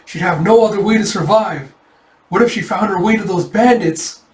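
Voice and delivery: fretful worry. Acted. Character frets over princess's fate.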